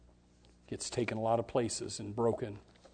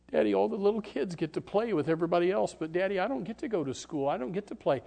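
a hint of a pleading child, higher and faster; little daughter; 7 semitones higher, faster than usual. Leper's daughter asking her father why, lightly childlike. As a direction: plead like a small girl to her dad. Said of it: "his little daughter".

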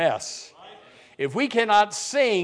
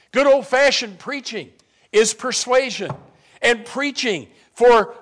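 a bit louder, steady preach; 5 semitones higher, 6 dB louder than usual. Acted. Preacher defending preaching to the church, firm preaching. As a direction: press it home.

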